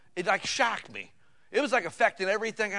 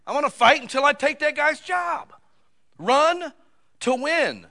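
loud, high, impassioned preacher; full-force preaching; 9 semitones higher, 8 dB louder than usual. Real, not acted. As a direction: drive it home, raising the voice to a shout.

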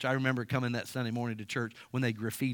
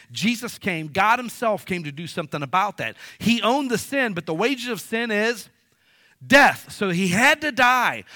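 preaching at full force; 7 semitones higher, 6 dB louder than usual. Real, not acted. Pastor preaches the resurrection to his church, at full force. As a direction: drive the gospel home like a revival preacher.

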